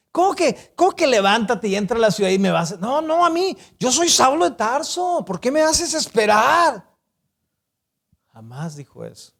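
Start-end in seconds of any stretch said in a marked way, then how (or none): 0.1-6.9 much higher, outraged and fast; mock arrogant Saul. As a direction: play a self-important man insulted at being kept waiting. Mock arrogant Saul complaining to God.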